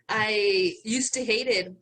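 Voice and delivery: normal talking voice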